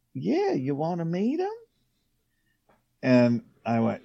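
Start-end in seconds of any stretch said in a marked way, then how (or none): 0.1-1.5 lightly voiced soft Southern lady; a hint of a sweet, high Southern voice; sweet soft-spoken Southern lady. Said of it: "this little sweet Southern voice and soft spoken".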